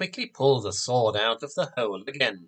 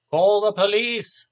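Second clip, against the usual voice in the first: high, loud heckling call; shout; 8 semitones higher, 5 dB louder than usual. A narrator's reading. Audience member heckling the magician, loud high shout. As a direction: yell it from the back of the hall. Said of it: "someone from the audience shouted".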